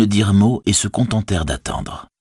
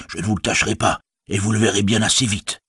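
ordinary delivery

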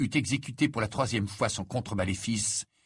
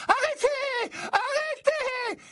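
very high, drawn-out shriek; Mimi Géniard crying out; much slower than usual. A narrator's reading. Ghost girl begging duelling boys to stop, shrieking. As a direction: wail it out, stretching the last syllable. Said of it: "s'écria Mimi Géniard".

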